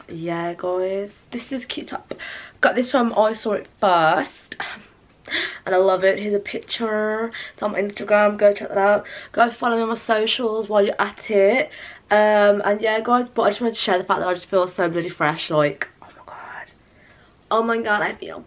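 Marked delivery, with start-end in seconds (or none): 1.3-2.1 whisper. Real, not acted. Whispered clothing plug. 16.0-16.7 whisper. Real, not acted. Whispered exclamation.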